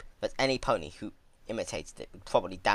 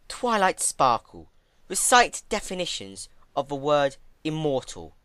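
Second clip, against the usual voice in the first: commanding mentor; 5 semitones higher, 6 dB louder than usual. A narrator's reading. Celestia commanding her old student to recite. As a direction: order her pupil like a stern teacher. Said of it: "Celestia commanded".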